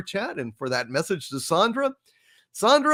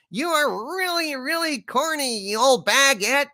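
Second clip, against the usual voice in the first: high, laughing fake exasperation; mock outrage; 9 semitones higher than usual. Host mock-scolding Beth over her corny pun, high and laughing. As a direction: pretend to be fed up, grinning through it.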